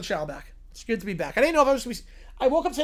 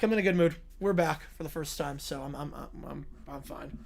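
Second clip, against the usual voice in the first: ordinary delivery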